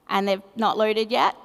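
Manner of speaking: usual speaking voice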